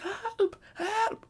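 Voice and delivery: hammed-up pitiful wail; mock cry for help. Imagined crying for help.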